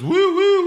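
high, loud train-whistle woo. Retold or imitated. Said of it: "puts his hand up and does".

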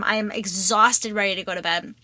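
normal talking voice

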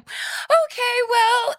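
squeaky falsetto, high up; mock cheerful squeak when scared; panicky high voice. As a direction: jump way up into a tight fake-calm pitch. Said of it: "your voice goes up really hi".

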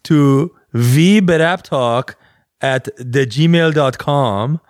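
slow, sing-song mock Iranian accent. Giving listeners the email, slow mock Iranian accent. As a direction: spell it out in a drawn-out Iranian accent. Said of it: "this is Iranian".

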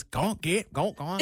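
imitated old man shooing; [name]. Co-worker picking up the routine, old man voice. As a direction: mimic someone copying an old man voice. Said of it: "[name] does it a little bit".

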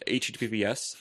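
ordinary talk

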